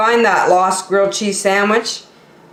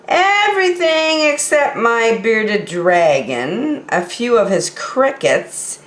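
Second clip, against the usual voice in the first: ordinary delivery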